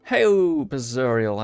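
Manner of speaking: usual speaking voice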